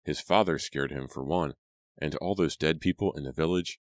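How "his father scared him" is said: plain reading voice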